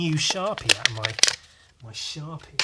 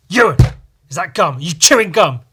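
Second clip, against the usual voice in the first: loud, sharp teacher demand; accusing teacher; 4 semitones higher, 12 dB louder than usual. Retold or imitated. Teacher catching a pupil chewing, loud accusing. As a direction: call him out for chewing in class. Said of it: "sees me chewing and goes".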